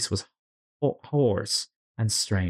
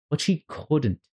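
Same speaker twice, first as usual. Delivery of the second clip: ordinary delivery, nothing marked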